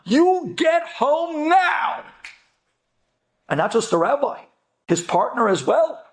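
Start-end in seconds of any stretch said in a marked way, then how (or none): 0.0-2.0 slow, drawn-out mock shout of a scared wife; frantic demand. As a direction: plead him home, stretching every word. Wife demanding husband come home.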